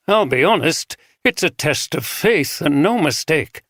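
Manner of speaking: lightly voiced northern cleric, straight-speaking; northern accent. A narrator's reading. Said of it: "pronounced traces of a northern accent".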